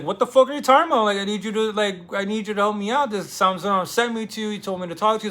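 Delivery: hammed-up frantic outburst; exasperated plea. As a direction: beg a stubborn drunk in frustration. Dimitri pleads, exasperated.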